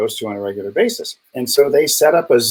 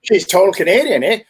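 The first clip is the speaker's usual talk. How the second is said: higher, put-on Canadian twang ending on a sing-song eh; mock Canadian accent; 7 semitones higher, 4 dB louder than usual. Teasing his Canadian guest in a mock Canadian accent. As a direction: tease her with a hammed-up Canadian voice.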